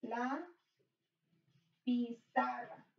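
slow repetition. Shown as a demonstration. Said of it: "so this one is la pizarra".

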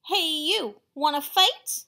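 loud, shouted dare; loud challenge. Acted. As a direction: shout the challenge up at something huge. Ladybug challenging the whale.

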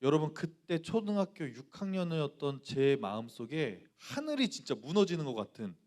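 ordinary delivery

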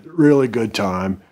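usual speaking voice

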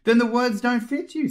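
high, loud gloating king; smug king. A narrator's reading. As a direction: deliver it like a winning joke. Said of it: "looking round with a smile".

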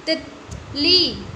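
तितली with short ति, long ली drawn out; teacher modelling तितली for children; stretched reading. Shown as a demonstration. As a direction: say तितली slowly, stretching the last ली. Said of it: "ऐसे ही बोलते हैं".

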